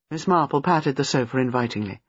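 ordinary delivery, nothing marked

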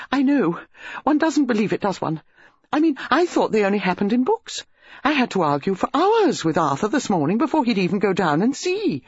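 high, gushing country lady; excited hostess. A narrator's reading. Mrs Bantry telling Jane about the body, high gushing. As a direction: rattle on, thrilled and scandalised.

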